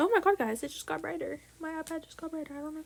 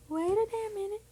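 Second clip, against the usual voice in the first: higher, louder double take; suddenly catching the mistake; real startled realisation; 8 semitones higher, 4 dB louder than usual. Real, not acted. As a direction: wait a damn minute, now alarmed. Repeats louder after catching mistake.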